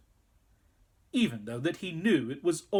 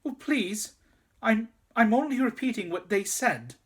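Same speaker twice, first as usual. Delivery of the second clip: higher, frightened stammer; 8 semitones higher, 4 dB louder than usual. A narrator's reading. Boy defending himself to the queen, frightened stammer. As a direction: stumble over the words in fear. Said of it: "stammered Edmund".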